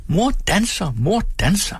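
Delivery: put-on high, excited childish chant; children shrieking with delight at their mother; children's shriek. A narrator's reading. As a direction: shriek it like thrilled kids. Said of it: "mens alle børnene skreg".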